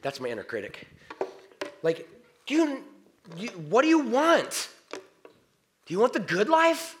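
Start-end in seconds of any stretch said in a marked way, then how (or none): 1.8-7.0 high, loud, slowed-down questioning; animated challenge. Real, not acted. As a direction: press each question, pitched high and loud.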